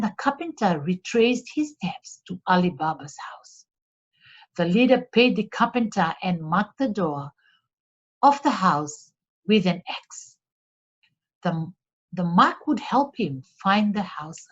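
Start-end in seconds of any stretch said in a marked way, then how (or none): none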